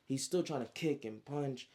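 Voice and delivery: usual speaking voice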